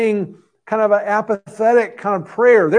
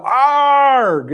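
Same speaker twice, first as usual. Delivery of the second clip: raised, drawn-out "arg" of frustration; mock cry letting off steam, higher pitched; wordless exclamation; 6 semitones higher, 4 dB louder than usual. Shown as a demonstration. Preacher imitates crying out in the air, wordless frustrated exclamation. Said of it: "wordless ex-, exclamation".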